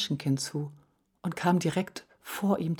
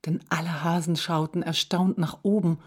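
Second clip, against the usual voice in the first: usual speaking voice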